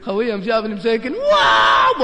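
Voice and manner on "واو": high, loud hammed-up "واو"; mock startled yelp. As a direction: yelp it in fake panic. Mocking the startled youth. (صرخة مفاجأة ساخرة عالية)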